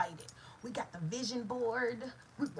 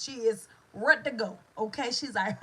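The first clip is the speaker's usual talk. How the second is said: normal talking voice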